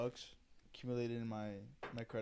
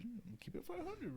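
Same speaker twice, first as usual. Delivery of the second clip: much higher, thin mocking echo of himself; squeaky mock repeat; 13 semitones higher, 8 dB quieter than usual. Repeating his own payday vow in a high mock voice. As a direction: jump way up in pitch for the repeat.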